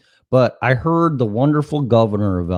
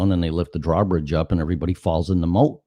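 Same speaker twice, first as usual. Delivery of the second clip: normal talking voice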